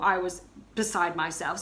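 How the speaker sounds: usual speaking voice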